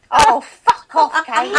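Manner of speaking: a hint of a crabby old crone, half laughing; cranky old woman. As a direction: swat Kate away like a grumpy old lady. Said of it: "and you'll be like".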